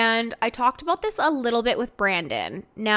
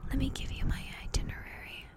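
whisper; 4 semitones higher, 10 dB quieter, faster than usual. Pretend. Joking secret itinerary reveal.

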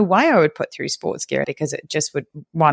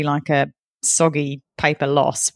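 ordinary delivery

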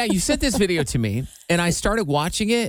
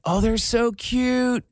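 mock gushing; 6 semitones higher, much slower than usual. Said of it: "I don't talk like that".

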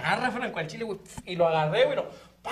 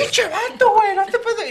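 squeaky, much higher jeering voice; mock taunt; 7 dB louder, slower than usual. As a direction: jeer at the guy in a high teasing pitch. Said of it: "Y lo".

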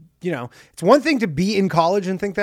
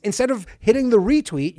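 usual speaking voice

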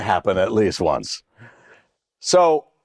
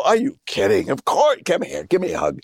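hammed-up praise; 7 semitones higher than usual. Faking praise to John.

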